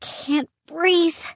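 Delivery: high, strained gasp for air; breathless alarm in the smoke; choking panic. Acted. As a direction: struggle to get the words out high and panicked.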